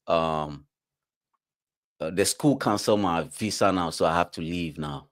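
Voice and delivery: a friend quietly owning up; subdued confession. Retold or imitated. Sam telling his friend he got caught, subdued and hesitant. Voicing Sam explaining deportation.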